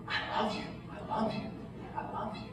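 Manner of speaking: whispered tenderly, unhurried, with pauses between; patient, loving parent. Retold or imitated. God as patient parent holding the tantruming mourner, tender whisper. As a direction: imitate a parent soothing a child in a whisper. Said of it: "like that patient parent, arms wrapped around me".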